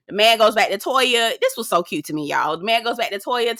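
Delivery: usual speaking voice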